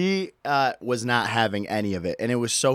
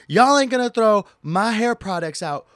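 high, loud mock scream; 7 semitones higher, 4 dB louder than usual. Lady screaming at TSA agents over her products. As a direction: ham up the lady's angry protest. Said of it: "like, screaming out loud".

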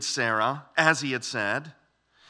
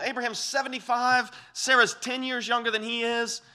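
higher, louder, scoffing voice rising to a disbelieving question; incredulous disbelief; 7 semitones higher, 4 dB louder than usual. Retold or imitated. Old couple scoffing at God's promise of a child, incredulous. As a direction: voice Abraham and Sarah laughing off an impossible promise. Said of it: "that kind of incredulous, unbelieving laughter".

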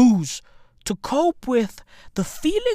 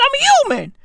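much higher, squeaky take of the line; squeaky comic version; mock high whine; 3 dB louder than usual. Shown as a demonstration. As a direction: squeak it up like a joke.

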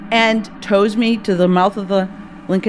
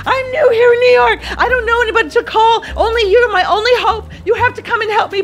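weeping and begging; 12 semitones higher, faster than usual. Retold or imitated. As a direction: beg through tears as if your life depends on it. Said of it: "I just weep and beg".

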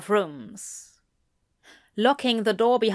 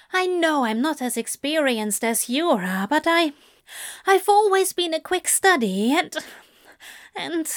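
high, halting angel voice; flustered angel; 7 semitones higher than usual. Acted. Angel assuring demon he learns quickly, high and halting. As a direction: plead your case, high, stumbling to a stop.

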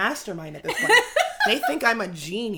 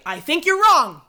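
louder, higher burst of disagreement; mock confrontation; 7 semitones higher, 9 dB louder than usual. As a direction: blurt it out as a raised-voice accusation. Retold confrontation with cup shuffler.

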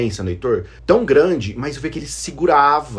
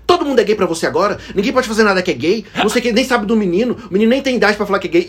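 fast, mock-indignant outburst; higher, hurried retold scolding; imitated angry rant; 6 semitones higher, faster than usual. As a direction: act out an angry brother's rapid rant. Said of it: "você falava bravo no carro assim".

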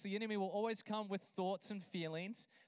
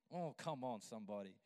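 much lower, softer tender close; quiet devotional; 10 semitones lower, 6 dB quieter than usual. Acted. Preacher gently invites the congregation, much lower and softer. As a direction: sink low and hush it.

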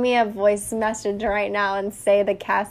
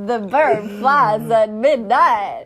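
slow, louder put-on character voice; Christopher Walken; 5 dB louder, slower than usual. Girlfriend trying an impression as partner laughs, slow, hammed-up voice. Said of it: "Christopher Walken".

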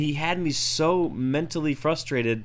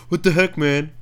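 louder, higher put-on whine; mock annoyed friend; 5 semitones higher, 8 dB louder than usual. Friend complaining about the podcast, loud put-on voice. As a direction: play the miffed buddy. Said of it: "But he goes".

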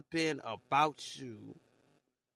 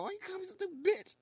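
high, squeaky mock voice, much higher; mocking the other person. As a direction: mimic them whining back at you, pitched way up. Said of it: "you be sitting there like".

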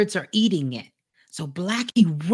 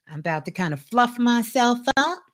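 normal talking voice

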